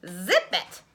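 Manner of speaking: loud, high scolding; little animals yelling at the bear to hush; scolding critters. Acted. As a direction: tell off the bear loudly.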